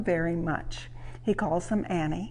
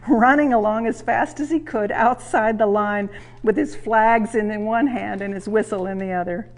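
ordinary delivery

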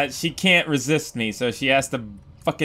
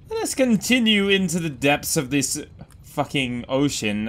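normal talking voice

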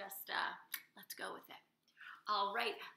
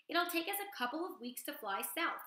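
lightly voiced Loudwing; 7 semitones higher than usual. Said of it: "said Loudwing".

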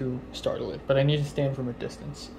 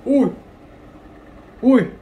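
high short scared yelps; startled yelp; 9 semitones higher, 7 dB louder than usual. Real, not acted. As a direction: jump and yelp in fright. Startled by cockroach moving.

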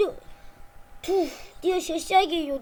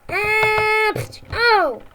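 collision yelp; 4 semitones higher, 8 dB louder, much slower than usual. Acted. Bumps into someone.

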